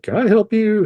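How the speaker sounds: the NICU nurse stopping a panicking dad, lilting up high; polite nurse voice. Retold or imitated. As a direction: play the helpful nurse, up high. Nurse intercepts him in nicu.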